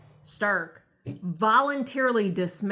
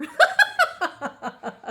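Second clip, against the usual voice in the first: high burst of laughter; real laugh; 7 dB louder than usual. Real, not acted. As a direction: laugh out at the absurd answer. Laughing at her answer.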